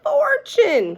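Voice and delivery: high, loud and slow; called out. A narrator's reading. Shirley calling out to the class, high and loud. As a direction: call it across the room. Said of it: "called Shirley".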